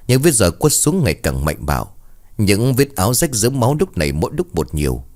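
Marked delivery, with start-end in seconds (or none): none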